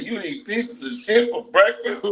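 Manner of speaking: high, mocking disbelief; incredulous question. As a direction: ask it high in mock disbelief. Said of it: "He said".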